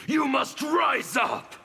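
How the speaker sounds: rallying shout. Acted. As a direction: rouse them to rise up. Revolutionary rallying oppressed listener.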